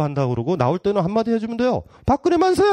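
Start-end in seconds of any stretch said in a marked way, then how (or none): 2.1-2.6 a cheeky mock cheer on the way out. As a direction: shout hurrah like a mock fan. Mock cheer leaving the Blue House.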